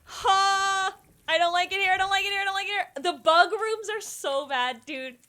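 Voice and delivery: high, loud, panicked repeating; frantic, wants out of the room now. Real, not acted. Gamer in a bug-filled room, loud panicked pleading.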